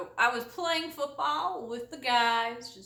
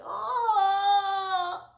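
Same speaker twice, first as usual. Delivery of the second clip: mock pained moan; 9 semitones higher, 4 dB louder than usual.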